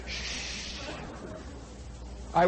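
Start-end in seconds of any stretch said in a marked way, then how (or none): 0.1-0.4 soft shushing hiss; hushing. Retold or imitated. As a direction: hush her quietly. Retold hushing.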